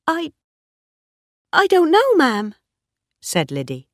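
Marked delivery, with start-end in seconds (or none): 0.1-2.6 very high, halting servant voice; hesitant maid. A narrator's reading. Liddy answers her mistress, hesitant and high. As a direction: stammer a timid answer, pitch raised high.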